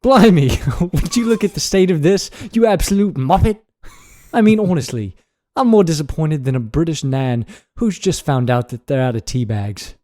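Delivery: put-on British scolding, punchy opening; British accent. Podcaster reads a mock nightly news script, British accent. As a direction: read it as an indignant British newsreader. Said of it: "read in a British accent".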